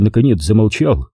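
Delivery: ordinary delivery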